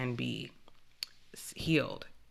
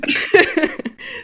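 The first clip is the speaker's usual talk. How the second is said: breathy embarrassed laugh; 14 semitones higher, 9 dB louder than usual. Real, not acted. Laughing off her soapbox.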